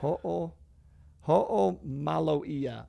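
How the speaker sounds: "ho'omaloia" very slow, broken after "Ho'o"; careful pronunciation. Shown as a demonstration. Guest retrying the Hawaiian word, "ho'omaloia" slow and careful. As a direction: sound out "ho'omaloia" part by part, very slowly. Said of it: "I think is how you say it".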